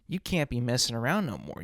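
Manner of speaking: ordinary talk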